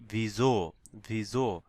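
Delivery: "ভিজো" as vee-zo, falling. Shown as a demonstration. Teacher gives example of S before vowel, voiced-z demo. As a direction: give "ভিজো" a clear buzzing z. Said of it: "ঝ উচ্চারণ হবে".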